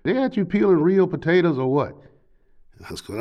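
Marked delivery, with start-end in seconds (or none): 0.0-1.9 high, excited, rambling questions; high eager drunk. Retold or imitated. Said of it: "he was still talking to me through the door".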